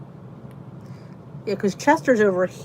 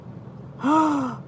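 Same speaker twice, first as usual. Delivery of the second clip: gasp; 6 semitones higher than usual. Real, not acted. Reacting to a food in chat.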